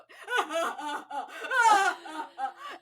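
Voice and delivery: loud, high mock sobbing wail; hammed-up hysterical bawling; imitated hysterical crying. Shown as a demonstration. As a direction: demonstrate an ugly cry, full volume. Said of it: "hysterically crying".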